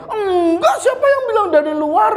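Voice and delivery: put-on a kid caught lying who shouts back. Lele denies to papa that he went out, high whiny shout.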